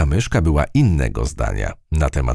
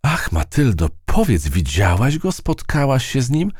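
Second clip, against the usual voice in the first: eager questions; 9 semitones higher than usual. A narrator's reading. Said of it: "wypytywała po powrocie gumka myszka".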